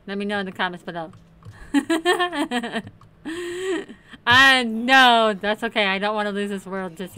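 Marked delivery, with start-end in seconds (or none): 1.4-4.2 laugh. Real, not acted. Laughing at own dare.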